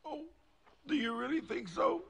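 high, sobbing question; tearful hope. Acted. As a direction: ask hopefully through sobs. Crying cat, comforted by the child.

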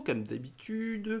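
"comme d'habitude" stretched slow and pitched high; sing-song, slow and high on comme d'habitude; drawn-out catchphrase. Shown as a demonstration. Running catchphrase of the review.

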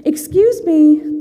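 high, slow, sweet call; a timid creature asking politely; polite little hedgehog. Acted. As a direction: call out gently like a small shy animal. Hedgehog calls politely to rat.

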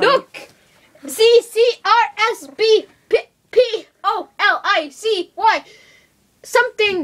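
high, loud letter-by-letter; excited reading aloud. Pretend. Luigi reading the sign to Mario, excited.

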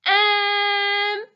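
high, hesitant slug voice; a shy, unsure little um; Doug's voice. Acted. Doug hesitating when meeting Gale, high and unsure. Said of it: "said Doug".

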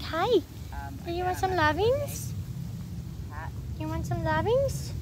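high, gentle pet talk; cooing to a cat. Real, not acted. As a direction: coax a kitty to come over. Offering petting to a cat.

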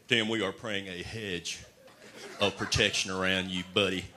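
slow solemn prayer voice. Retold or imitated. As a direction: intone it like a church prayer. Parody of a praying believer.

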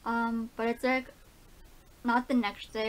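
ordinary talk, nothing marked

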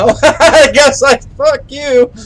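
high, loud laughing outburst. Real, not acted. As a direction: crack up at the ghost. Ghost ignores him.

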